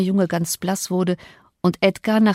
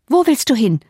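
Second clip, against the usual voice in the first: high, loud challenge; urgent raised-voice question; sharp demand; 6 semitones higher, 5 dB louder than usual. A narrator's reading. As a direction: demand it, blocking his way. Catherine blocks the visitor's way out.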